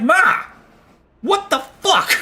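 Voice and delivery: high, loud outraged "Ma, what the fuck"; shocked shout. Retold or imitated. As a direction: yell it in disbelief at mom. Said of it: "And I'm like".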